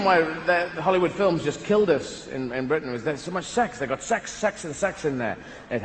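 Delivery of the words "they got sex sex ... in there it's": usual speaking voice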